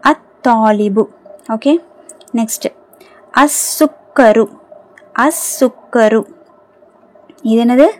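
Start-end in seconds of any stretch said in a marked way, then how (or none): 0.0-1.1 slow "அத்தாலிபு", no L after the A, doubled T; silent lam. Shown as a demonstration. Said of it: "லாம் உச்சரிக்கப்படலை". 3.3-6.2 slow "அஸ்ஸுக்கரு", no L, doubled S; silent lam. Shown as a demonstration. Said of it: "லாம் உச்சரிக்கப்படலை".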